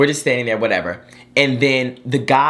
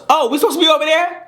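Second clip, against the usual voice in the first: high, quick mock-innocent question; put-on high mom voice; imitated oblivious mom; 4 dB louder, faster than usual. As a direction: chirp it like a clueless mom, fast and high. Son imitates oblivious mom realizing.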